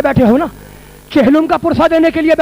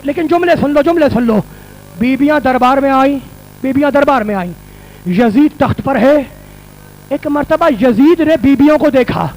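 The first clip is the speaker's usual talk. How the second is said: ordinary delivery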